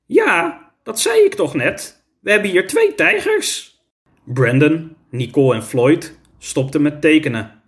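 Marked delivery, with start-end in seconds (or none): 0.1-3.8 much higher teacher character voice; matter-of-fact teacher, mildly insistent. A narrator's reading. Teacher confirms tigers to startled pupils, high insistent voice. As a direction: confirm it as if it were obvious.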